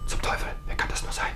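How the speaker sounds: hissed, alarmed whisper; startled whisper. Acted. Husband whispering when the doorbell rings. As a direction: whisper it, caught off guard.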